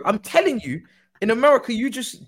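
ordinary talk, nothing marked

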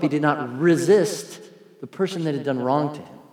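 ordinary delivery

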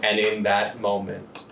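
slow, hushed suspense narration; dramatic narration. Acted. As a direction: draw out each beat for tension. GM narrates tense scene.

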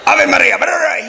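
very high, loud mock-furious prayer; screamed rosary. Priest praying the angriest rosary at the crowd, imitated screaming. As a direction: hurl the prayer at them like an insult. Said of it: "He's just, like, screaming the rosary at him".

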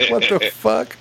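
high incredulous exclamation. Real, not acted. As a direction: squeak it out in disbelief. Outraged exclamation, high pitch.

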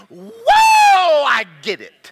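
huge high whoa falling into the words; revelation whoop. Retold or imitated. Preacher relives the Holy Ghost talking to him, ecstatic whoop. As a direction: whoop with sudden understanding, very loud. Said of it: "and I went".

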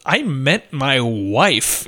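"I meant my wife" spaced out slowly, low; deliberate rebuff. Retold or imitated. Husband rebuffing the nanny, slow deliberate correction. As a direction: land each word of "I meant my wife" slowly.

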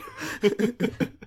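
breathless whispered laugh. Real, not acted. Still giggling.

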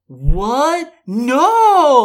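high, loud, overacted shock; rising question, then a long wailed denial. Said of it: "He just says it like".

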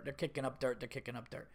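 normal talking voice

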